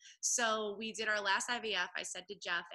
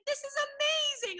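much higher, louder delighted cry; thrilled; 7 dB louder than usual. Retold or imitated. The husband hearing she is pregnant again, a high delighted cry. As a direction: gush it like an overjoyed husband.

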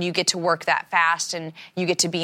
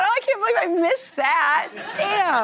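high mock dismay; 12 semitones higher than usual. Retold own reaction, much higher.

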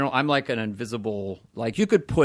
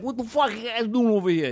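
brash Italian accent; 9 semitones higher than usual. Shown as a demonstration. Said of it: "like, an Italian accent".

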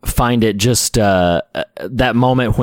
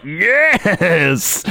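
mock cheer; 7 semitones higher than usual. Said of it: "And it's like".

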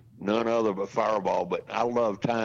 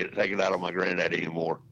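normal talking voice

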